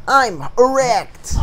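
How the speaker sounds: high, slow, drawn-out announcement; drawn-out declaration. Pretend. Player riffing on the game, drawn-out declaration.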